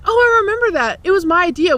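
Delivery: mock-innocent mom claiming credit; imitated cheerful mother; breezy mom. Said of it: "then she's like".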